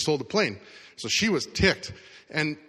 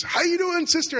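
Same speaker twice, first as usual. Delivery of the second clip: very high, imitated panicked shriek; mock scream. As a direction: imitate a terrified passenger screeching to her sister. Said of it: "and then she screams".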